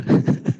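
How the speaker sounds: short chuckle; brief amused laugh. Real, not acted. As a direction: burst of chuckling mid-talk. Preacher laughs mid-remark.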